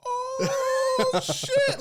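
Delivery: high, squealing laugh of shock; shocked laughter. Retold or imitated. Kids hearing a cruel nickname, squealing shocked laughter. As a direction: crack up in disbelief.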